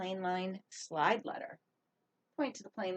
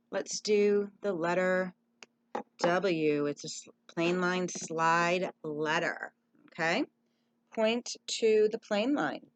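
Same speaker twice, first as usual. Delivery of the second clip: usual speaking voice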